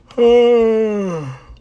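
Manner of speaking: long, loud falling sigh. Real, not acted. Singer reminded of embarrassing cover, loud sigh. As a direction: groan out a big falling sigh.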